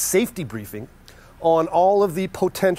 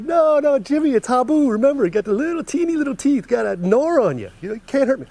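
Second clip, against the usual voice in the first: carefree Craig; 9 semitones higher than usual. Retold or imitated. Friend insists to the narrator that the snake is harmless, high cocky voice. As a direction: play him high-pitched and cocky, waving off the danger.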